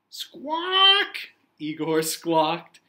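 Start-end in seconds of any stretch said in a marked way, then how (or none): 0.1-1.3 very high, loud parrot screech; parrot squawk. Acted.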